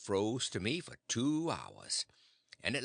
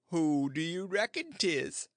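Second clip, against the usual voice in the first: smiling, raised, teasing question; beaming aunt; 5 semitones higher, 3 dB louder than usual. Acted. Aunt presenting the boy, smiling and teasing. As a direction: tease him with a grin in the voice. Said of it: "a beaming and a smiling".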